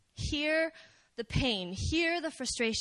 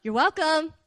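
pointed you're welcome; 4 semitones higher, 7 dB louder than usual. Retold or imitated. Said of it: "you just go".

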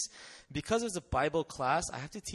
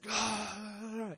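possessed cry; 6 semitones higher than usual. Retold or imitated. Possessed boy cries out.

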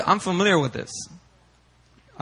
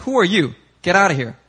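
louder, higher retold challenge; hostile policeman; 5 semitones higher, 6 dB louder than usual. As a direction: order an intruder away.

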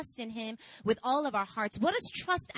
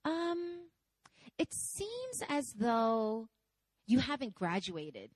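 administrator; much slower than usual. Retold or imitated. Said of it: "She goes".

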